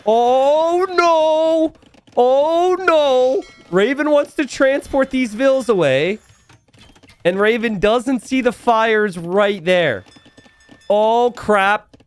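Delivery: panicked repeated oh God, high and loud; frantic dread as disaster hits; anxious stammering. Real, not acted. Villagers about to die.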